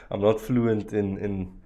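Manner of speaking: usual speaking voice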